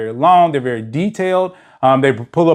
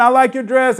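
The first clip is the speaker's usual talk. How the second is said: chirpy compliment impression; 10 semitones higher than usual. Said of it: "He likes to say".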